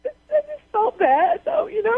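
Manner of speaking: upset, high-pitched crying voice; really tearful and high. Real, not acted. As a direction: plead tearfully, pitch pushed up. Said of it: "you crying about the situation".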